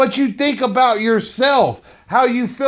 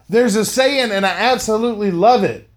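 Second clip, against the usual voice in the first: normal talking voice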